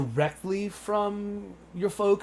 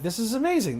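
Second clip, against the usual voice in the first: higher-pitched delighted reaction; retold excitement; 8 semitones higher than usual. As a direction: recall being thrilled by a lucky find.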